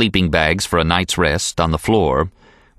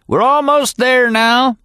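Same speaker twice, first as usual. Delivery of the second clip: higher, slow, stretched "almost"; old packer's yell; 4 dB louder than usual. A narrator's reading. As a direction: draw out "almost" like a long holler. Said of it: "finally Happy yelled from up ahead".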